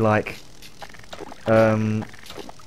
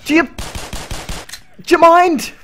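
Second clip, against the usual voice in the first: high, loud exasperated protest; indignant shout; 4 dB louder than usual. Real, not acted. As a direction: shout at the enemies to back off. Yelling at game interruption.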